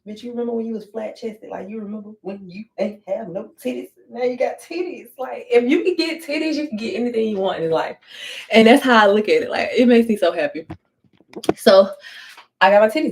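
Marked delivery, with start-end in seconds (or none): 0.1-6.8 self pep talk. Retold or imitated. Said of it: "I be looking at my titties like".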